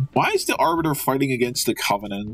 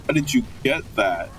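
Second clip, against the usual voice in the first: normal talking voice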